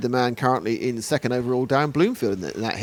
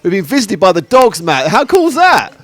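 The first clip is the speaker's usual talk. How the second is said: louder and higher, laughing with delight; thrilled; 6 semitones higher, 7 dB louder than usual. Real, not acted. Commentator thrilled to tell Matt about the dogs. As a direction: share the joy with Matt.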